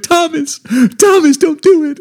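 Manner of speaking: very high, loud plea; panicked high-pitched yell; screaming mom. Retold or imitated. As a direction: imitate a frantic mother begging him to stop. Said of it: "she's instantly screaming".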